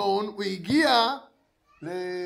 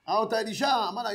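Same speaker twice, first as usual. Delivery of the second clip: normal talking voice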